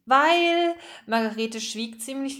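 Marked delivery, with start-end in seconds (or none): 0.1-0.8 high, loud, drawn-out word left hanging; stalling. A narrator's reading. Margarete asked why not, stalling. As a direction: stretch it while hunting for an excuse.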